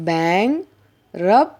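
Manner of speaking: Bang, pause, రప్, each chunk on its own; separated syllables bang and రప్, T silent; broken into syllables. Shown as a demonstration. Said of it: "విడదీసి పలకడం ద్వారా".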